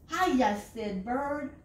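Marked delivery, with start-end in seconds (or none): 0.1-0.6 higher, loud bright hello; cheery greeting. A narrator's reading. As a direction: burst in cheerfully. Bird wakes, cheerful greeting.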